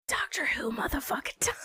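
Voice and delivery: giggly hushed whisper; excited whisper. Real, not acted. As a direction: whisper it like a secret thrill. Hyped whisper of game start.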